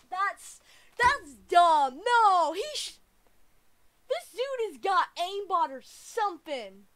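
high, loud indignant complaining; angry accusation. Real, not acted. Gamer accusing dude of aimbot, angry loud complaint. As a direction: protest loudly that you were cheated.

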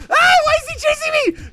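very high, fast panicked shout; high-pitched squeal of game panic; mock panic. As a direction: yell it like you're being chased. Chased by villain in game.

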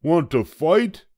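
high, loud, grumpy little bug; a tiny bug spoiling for a fight, high and loud; grouchy ladybug. A narrator's reading. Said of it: "said the grouchy ladybug".

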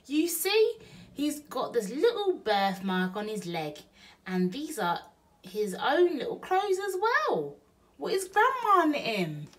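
high, eager woman's chatter; Betty proudly shows Bill the baby's things; Burglar Betty. Acted. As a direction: gush about the baby.

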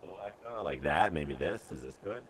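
deep filtered voice with echo; voice changer. Real, not acted. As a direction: demon voice through an effect. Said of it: "you sound like shit".

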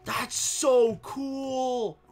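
very slow, savoured; drawn-out awe. Real, not acted. Gamer admiring a new crossbow, slowly awed. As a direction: let it out slowly in wonder.

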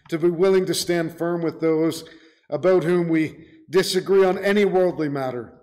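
ordinary delivery

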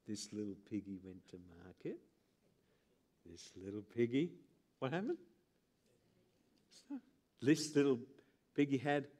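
low, soft singsong nursery rhyme voice. Pretend. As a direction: play with his toes like a mocking parent. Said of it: "and I would go".

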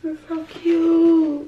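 high, slow gushing over cute gift; high drawn-out delighted coo. Real, not acted.

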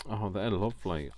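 usual speaking voice

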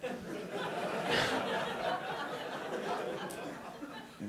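long high breathy laugh; big laugh. Real, not acted. Laughing after telling the guy to work it out. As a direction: laugh freely at the punchline.

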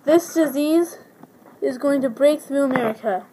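ordinary talk

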